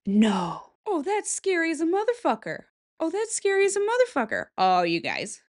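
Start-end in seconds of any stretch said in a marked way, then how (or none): 0.9-2.6 high, spooked reaction; real fright at a photo; scared gasp. Real, not acted. 3.0-4.5 even higher and faster, really spooked; higher spooked cry. Real, not acted. Repeats, more frightened.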